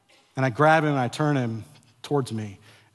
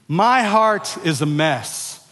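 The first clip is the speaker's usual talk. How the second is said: very slow, spaced-out call to echo; slow declaration; 4 semitones higher, 6 dB louder, much slower than usual. Shown as a demonstration. Pastor repeating the confession slowly with his congregation. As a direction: draw every word out for the crowd. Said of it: "Let's say it together".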